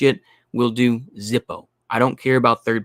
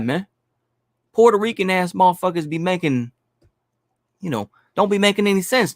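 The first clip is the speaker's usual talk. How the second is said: mock New York street accent, pitched up; pitched-up put-on New York accent; fake New York accent; 6 semitones higher than usual. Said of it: "throw on my fake New York accent right now".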